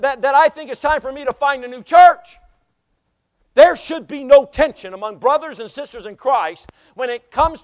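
loud, impassioned pulpit delivery; full-force preaching. Real, not acted. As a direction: drive it home to the congregation, loud.

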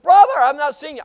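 loud, high acted greeting; hearty greeting. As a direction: greet a long-unseen brother at full voice.

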